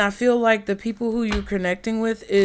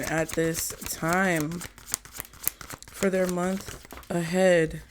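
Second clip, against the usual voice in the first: prayerful invocation; 4 dB quieter than usual. Acted. Tarot reader calling on guardian angels for viewers, softly reverent. Calls on spirits for guidance.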